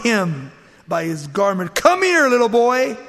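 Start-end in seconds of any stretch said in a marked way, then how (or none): 1.7-3.0 loud, high-pitched beckoning; seductress calling out. Acted. Wife grabbing Joseph by his garment, calling him loudly.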